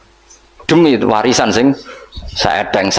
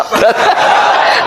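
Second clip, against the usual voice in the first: laugh; 10 semitones higher than usual. Real, not acted. Preacher laughs at own joke.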